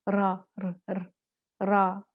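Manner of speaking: slow रा and र with a rolled tongue tip; trilled r. Shown as a demonstration. Teacher demonstrating the takrar of the letter ra. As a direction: let the r vibrate. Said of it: "ये तकरार है".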